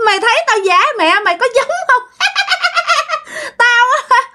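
put-on very high-pitched old demon hag, cackling between phrases. Old demon woman mocking the frightened girl, high cackling voice. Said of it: "Bà làm vậy đó các bạn".

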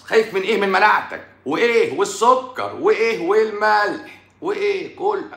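high, stretched-out questions; mocking interrogation. Real, not acted. Grilling a fearful patient about what scares him, mocking.

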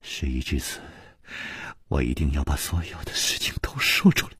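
a sorrowful hushed vow; mournful whisper. Acted. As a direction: breathe out a sad resolve. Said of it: "凄然说".

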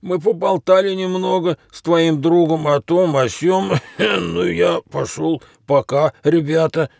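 high-pitched, sheepish excuse; backing off in a high character voice; flustered. A narrator's reading. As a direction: cough and make an awkward getaway. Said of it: "растерянно пробормотал Рэп".

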